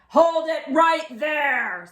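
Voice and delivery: slow, loud, commanding policeman; stern policeman. A narrator's reading. Policeman ordering the fleeing burglars to stop. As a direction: freeze them with a command. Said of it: "said the policeman".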